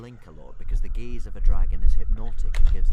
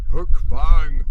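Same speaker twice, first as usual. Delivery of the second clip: loud, higher-pitched roar of rebuke; bellow; 7 semitones higher, 8 dB louder than usual. A narrator's reading. Stoic catching his dragon clawing Toothless, loud bellow. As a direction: bellow the name in warning. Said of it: "bellowed Stoic".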